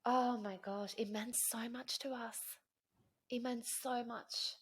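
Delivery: real emotion welling up. Real, not acted. House meant so much to us, genuinely emotional. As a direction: let the feeling well up as you speak. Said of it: "I'm actually getting emotional talking about it".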